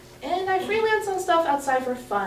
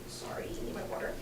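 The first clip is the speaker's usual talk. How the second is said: whispered aside; 16 dB quieter than usual. Real, not acted. Aside while reaching for water.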